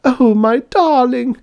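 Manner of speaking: slow, very high, loud endearment; unhappy maiden's cry. A narrator's reading. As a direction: wail it adoringly to your lover. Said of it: "cried the unhappy maiden".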